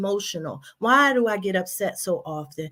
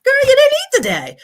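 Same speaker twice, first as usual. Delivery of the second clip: loud, high-pitched mock telling-off; scolding self-talk; 9 dB louder than usual. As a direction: tell yourself off in exasperated sudden realization. Acted scolding of herself.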